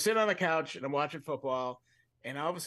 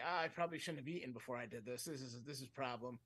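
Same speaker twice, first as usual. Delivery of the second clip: queasy mutter; 3 semitones lower, 10 dB quieter than usual. Retold or imitated. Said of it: "I started getting nauseous".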